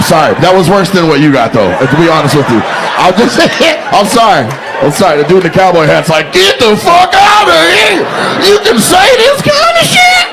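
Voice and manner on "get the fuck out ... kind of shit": very high, drawn-out comic yell; slow, high-pitched hollering; mock astonished outburst. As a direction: imitate an audience member hollering in disbelief. Acts out astonished man in cowboy hat.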